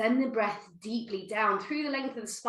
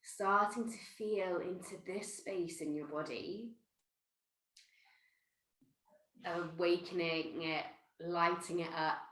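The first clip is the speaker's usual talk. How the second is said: soft, gentle meditation voice; softer meditation guiding after the hum; guided meditation; 7 dB quieter than usual. Acted. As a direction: ease them inward, softly.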